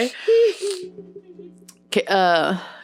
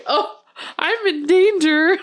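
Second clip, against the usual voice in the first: laughing mimic; 8 semitones higher, slower than usual. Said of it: "you were like this".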